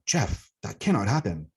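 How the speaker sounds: slow, grave, concerned refusal; concerned, firm. Retold or imitated. Elder refuses Jeff's plea, slow and concerned. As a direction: lean in and let him down gently but firmly. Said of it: "She leaned in very concerned and said".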